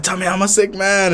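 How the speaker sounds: put-on high-pitched drunken demand; imitating drunk insistence. Retelling his drunk demand to Craig, high insistent plea. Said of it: "I was slurring my words".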